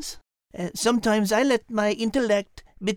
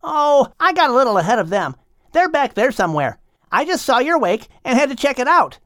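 a cheeky bundle of energy; playful young dolphin; 2 semitones higher, 7 dB louder, faster than usual. Acted. The young dolphin quizzes the whale, bouncy and cheeky.

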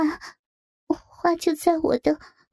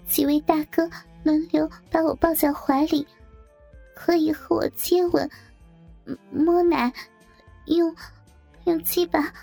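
much higher, halting, distressed voice; pained; 6 semitones higher than usual. A narrator's reading. The coerced bridesmaid goes on reciting rules, pained. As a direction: recite reluctantly, high and pained. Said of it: "继续痛苦地说".

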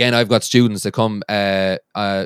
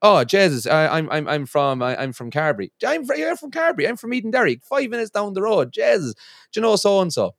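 high, eager, nosy Irish local; mock nosy Irish local; 7 semitones higher than usual. Wife mimicking Irish people who ask where you're from, eager and high. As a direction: play the excited local quizzing a stranger.

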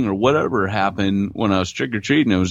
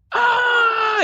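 high-pitched mock scream; short shrill pretend scream of terror; imitated scream. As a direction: imitate a terrified child's shriek. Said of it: "screaming, tears".

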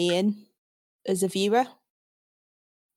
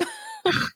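laugh. Real, not acted. Joke about paying for keyboards.

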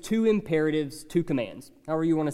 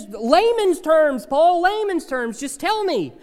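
mock impatient plea; 9 semitones higher, 4 dB louder than usual. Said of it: "we say".

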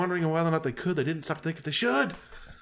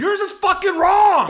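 much higher, much louder mock-furious yell; shout; 8 dB louder than usual. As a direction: explode at the co-host over the box. Outraged outburst at co-host.

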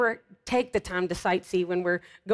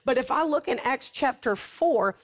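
raised-pitch, driving sermon delivery; fervent preacher building her case; impassioned preaching; 5 semitones higher than usual. Real, not acted. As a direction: preach it with conviction from the soapbox.

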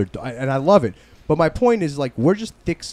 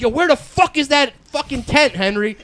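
high, loud, hammed-up yelling at a buddy; mock angry shouting; 8 semitones higher, 6 dB louder than usual. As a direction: bark it out like you're fighting over a lost tent. Acted friends' quarrel over tent.

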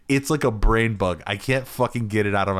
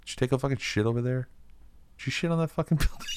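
quiet, fast, pitched-up cop demand; serious cop voice; 4 semitones higher, 5 dB quieter, much faster than usual. Retold or imitated. Cop demanding answer from suspect, fast and quiet. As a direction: hiss the question at the suspect. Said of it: "really serious".

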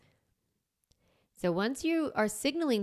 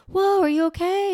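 high, loud worried whoa; alarmed concern; 10 semitones higher, 8 dB louder than usual. Retold or imitated. Friend reacting to the coyote story, loud high alarm. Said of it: "And they're like".

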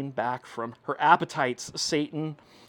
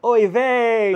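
higher, louder exasperated oy vey; angels groaning; 8 semitones higher, 9 dB louder than usual. Retold or imitated. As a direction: sigh it like a fed-up onlooker. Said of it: "The angels are just going like".

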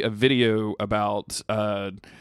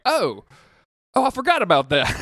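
high, louder oh of remembering; sudden realization; 10 semitones higher, 5 dB louder than usual. Retold or imitated. Dad realizing the Blu-ray sits by his TV, high surprised. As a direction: caught out, oh I forgot.

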